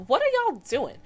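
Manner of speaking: high, loud retold challenge; mock exasperated yell. Tamika to her costumed classmates, mock exasperated.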